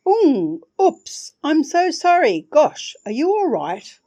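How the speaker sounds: high, lilting flustered apology; a light, musical startled sorry; tinkling sing-song voice. Acted. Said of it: "said a tinkling, sing-song voice".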